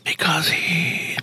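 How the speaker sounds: hushed, quick half-whisper; whispered aside. Real, not acted. Host whispering a joke aside to the co-host. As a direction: say it under your breath, fast.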